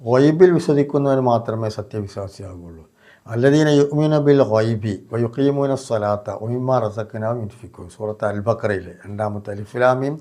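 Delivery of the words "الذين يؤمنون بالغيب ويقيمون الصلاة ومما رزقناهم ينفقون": slow, measured Quranic chanting; Quran recitation. Shown as a demonstration. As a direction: draw out each word like a recitation. Quran verse recited in sermon. (ഖുർആൻ പാരായണ ശൈലി)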